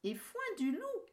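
mother goat calling. Acted. Real mother returns.

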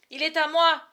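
high, loud protest; crying out. Acted. Boy cries out that the hawk is his, loud. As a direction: shout your claim to the bird. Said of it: "s'écria Martin".

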